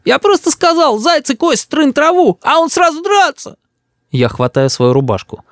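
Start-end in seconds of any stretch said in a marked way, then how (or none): 0.0-3.6 put-on very high child's whimper; whining. A narrator's reading. Boy complains about father to grandparents, whining. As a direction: whine it up high in self-defence. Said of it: "Рис начинает хныкать".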